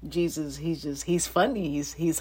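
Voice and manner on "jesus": normal talking voice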